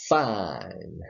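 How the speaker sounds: Fine in a Southern drawl, i stretched; southern accent. Shown as a demonstration. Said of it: "I speak with a little bit of a southern accent".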